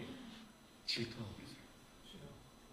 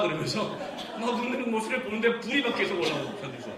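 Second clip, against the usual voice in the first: normal talking voice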